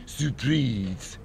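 surprise said as if French, "soo-preez"; French pronunciation. Acted. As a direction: put a French accent on surprise. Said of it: "as the French say".

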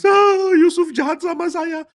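loud, very high mock wail; badly wronged act, wailing; fake crying. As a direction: bawl theatrically, slow and drawn out. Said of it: "Dia pura-pura menangis".

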